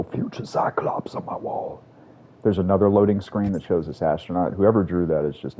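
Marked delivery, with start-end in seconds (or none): 0.0-1.8 whisper. Real, not acted. Joking aside about wall art.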